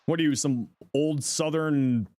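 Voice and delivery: ordinary talk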